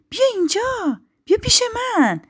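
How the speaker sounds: very high, coaxing call; kindly inviting. A narrator's reading. Spruce invites the hurt bird over, kindly. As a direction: beckon the bird over in a lifted, kind voice. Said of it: "درخت صنوبر با مهربانی گفت".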